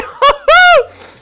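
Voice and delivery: shrieking burst of laughter; loud high laugh. Real, not acted. Laughs at getting inside.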